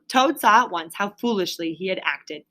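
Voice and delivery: ordinary delivery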